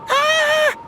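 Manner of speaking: high startled shriek. Acted. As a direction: let out a high scream as she drops. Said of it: "she shrieked".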